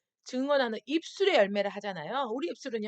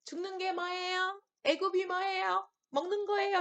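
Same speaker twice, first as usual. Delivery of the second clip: put-on innocent child; 7 semitones higher than usual. Innocent Israelite children asking about death, high childlike wonder. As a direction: ask like a puzzled little kid who knows nothing. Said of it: "순수한 자녀들".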